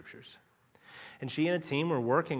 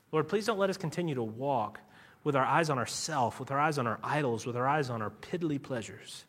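closing prayer; 2 semitones lower than usual. Acted. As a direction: pray over the congregation.

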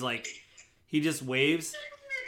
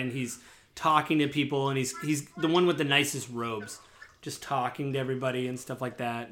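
normal talking voice

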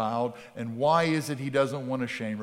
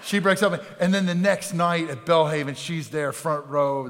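louder, higher impassioned preaching; preaching at full force; 4 semitones higher, 7 dB louder than usual. Acted. Pastor preaching passionately to his congregation. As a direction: pour it out from the pulpit.

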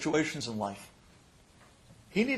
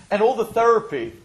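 ordinary talk, nothing marked

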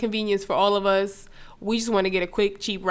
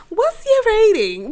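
much higher, slow incredulous question; mock disbelief; 11 semitones higher, 5 dB louder than usual. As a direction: ask it as if you can't believe it. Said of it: "And I open Lyft, like".

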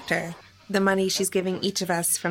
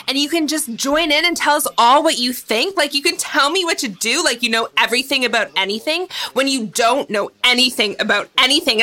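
angry rant; 3 semitones higher, 4 dB louder than usual. Acted. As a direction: let the anger climb until you're yelling. Sister blowing up at sibling.

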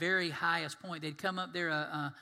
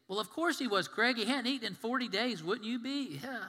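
higher-pitched, acted obviousness; incredulous retort; 5 semitones higher than usual. Listener answers Craig about forty days without food, mock incredulous. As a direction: toss it back as an indignant objection. Acts out listener's reply to preacher.

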